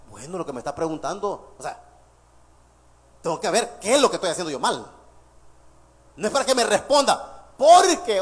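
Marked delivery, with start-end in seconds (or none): 0.1-4.8 much lower, softer musing to oneself; a hint of a chastened listener thinking it over; quiet self-reflection. Listener voiced reconsidering their mistake, low and soft. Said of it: "que recapacite y diga".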